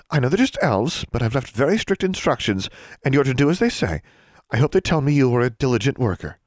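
prim librarian. A narrator's reading. Librarian assigning a student's detention work, prim high voice. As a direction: give orders as a librarian.

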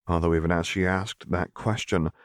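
usual speaking voice

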